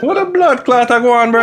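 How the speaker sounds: put-on high, playful Jamaican accent; a joking Jamaican accent. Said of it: "in my Jamaican accent".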